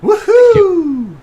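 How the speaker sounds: high whoop, trying it out; copied whoop. Pretend. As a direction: imitate the miner whoop. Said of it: "I'm gonna have to practice that".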